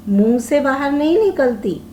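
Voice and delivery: ordinary delivery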